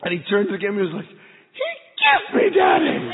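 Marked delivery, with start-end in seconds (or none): 1.5-3.1 put-on very high, loud, overjoyed kid cry; thrilled child shout. Son turning to dad's camera after the dolphin kiss, thrilled high shout. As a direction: shout the news like the best day ever.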